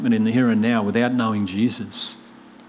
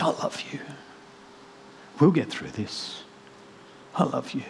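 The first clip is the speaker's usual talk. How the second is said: breathy murmured "I love you"; soft tender whisper, slowing down; hushed whisper; 3 semitones higher, 9 dB quieter than usual. Retold or imitated. Mother comforting her caught daughter, whispering. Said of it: "She said".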